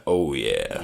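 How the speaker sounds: deep processed robot voice; low booming voice, inhaled; booming metallic robot. Pretend. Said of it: "Is that a soundboard?".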